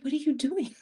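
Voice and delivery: a playful retold "what are you doing"; mock scolding. Tells friend off in street.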